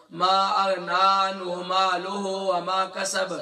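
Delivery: "ما اغنا عنہ مالہ وما کسب" drawled slowly with the غ softened, mocking the common error; slow mock recitation of "ما اغنا" with the throat letter weakened; wrong Punjabi-style recitation. Teacher imitating how people misread "ما اغنا". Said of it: "وہ کیا پڑھتے ہیں".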